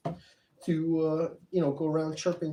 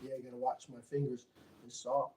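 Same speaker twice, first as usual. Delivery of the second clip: ordinary delivery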